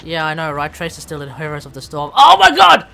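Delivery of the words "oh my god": loud, high-pitched yell; exasperated outburst. Real, not acted. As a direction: explode in exasperation, far louder and higher. Feigned outburst at talk of Blizzard.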